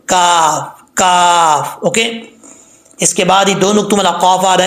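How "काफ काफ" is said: "काफ" with a light, thin k and long vowel; drawn-out "काफ", light k; thin kaf. Shown as a demonstration. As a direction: say "काफ" thinly, back of tongue on the palate. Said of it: "तो बरीक काफ पढ़ा जाता है".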